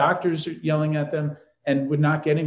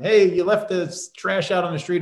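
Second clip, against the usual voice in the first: lightly voiced neighbor, a bit higher; complaining neighbor; 4 semitones higher than usual. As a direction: play the grumbling neighbor. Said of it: "the neighbor would come over and say".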